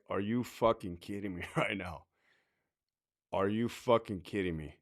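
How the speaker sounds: low, quiet exasperation; muted disbelief. Acted. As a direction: say it under your breath, dumbfounded. Acted reaction to hopeless colleagues.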